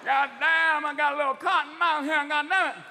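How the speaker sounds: high, loud bellowing horse; lost, stoned horse. Acted. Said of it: "Even the horse will be going".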